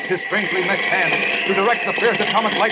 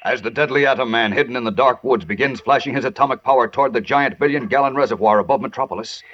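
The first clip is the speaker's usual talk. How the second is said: dramatic narration; 4 semitones lower than usual. A narrator's reading.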